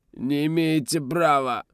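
high, slow, bleating whine; bleating protest. A narrator's reading. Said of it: "негромко заблеял Гасан".

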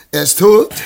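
blurting out his hope that he's dead; eager surprise. Acted. Merchant to servant, eager that the old man died.

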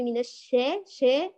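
الشا fronted toward shee or sheh; imala-style sheh for الشا; tilted vowel. Shown as a demonstration. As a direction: tilt the aa of شا into an e. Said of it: "بإمالتها".

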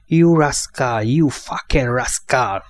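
slow furious hiss through clenched teeth. A narrator's reading. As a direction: spit each word out, barely holding back rage. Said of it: "sziszegte összeszorított fogakkal". (összeszorított fogakkal, lassan sziszegve)

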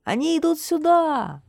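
high, excited calls building to a loud shout; Yantye calling out. A narrator's reading. Lookout on tower announcing the returning crowd, excited shouting. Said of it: "крикнул Янтье".

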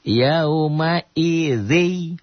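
slow chanted "Yauma idzi" with the n merged into the doubled dz; drawn-out recitation of "Yauma idzi", nun swallowed; reading demo. Shown as a demonstration. Teacher answering how it is read, slow chanted recitation. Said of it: "Dibacanya gimana?".

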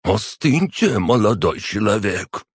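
high-pitched haughty rebuke; severe, disdainful command; stern, slightly contemptuous. A narrator's reading. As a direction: put an upstart in his place. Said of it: "сурово и немного презрительно проговорил Фламель".

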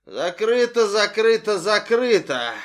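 loud slurred chant, losing control; drunk mother. Acted. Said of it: "голос абсолютно пьяного, теряющего над собой контроль". (пьяный голос матери)

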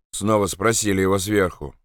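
plain reading voice

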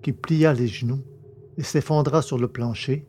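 usual speaking voice

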